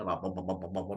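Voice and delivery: ordinary talk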